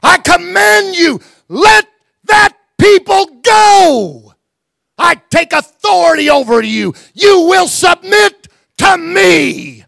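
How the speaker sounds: furious preacher's shout, every word hammered; screaming command. Retold or imitated. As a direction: bellow it at the courthouse like a rebuke. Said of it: "dropped my finger screaming at that courthouse".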